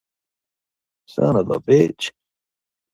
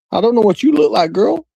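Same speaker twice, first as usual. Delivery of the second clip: sassy retort; 10 semitones higher than usual. Real, not acted. Teasing retort to Michelle.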